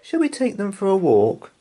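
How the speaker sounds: put-on high, small child's voice; little girl Anna. A narrator's reading. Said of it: "said Anna".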